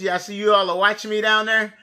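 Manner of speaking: ordinary talk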